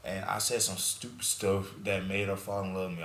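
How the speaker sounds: ordinary talk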